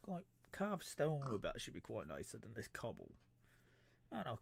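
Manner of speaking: ordinary delivery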